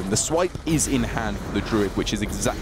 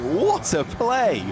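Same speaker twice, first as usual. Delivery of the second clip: excited; 11 semitones higher, 3 dB louder, much slower than usual. Real, not acted. Caster reacts to big play.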